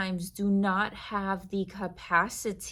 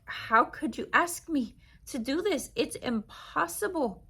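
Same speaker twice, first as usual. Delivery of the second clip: higher, pleading protest to God; hurt believer protesting; 7 semitones higher than usual. Acted. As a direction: plead with God like someone deeply wronged.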